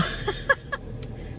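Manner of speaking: high unvoiced breathy laugh; a short airy giggle at herself. Real, not acted. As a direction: let out a sheepish airy laugh. Embarrassed after nurse's compliment.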